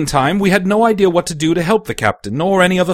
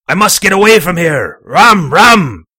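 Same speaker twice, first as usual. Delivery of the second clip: loud, high, urgent captain; frantic old captain, loud and insistent; desperate demand; 3 semitones higher, 8 dB louder than usual. Acted. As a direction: shout it in rising need.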